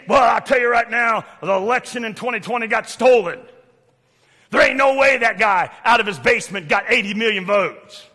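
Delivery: loud, riled-up congregant; mock indignant protest. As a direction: rant like an outraged church member. Acts out indignant church member.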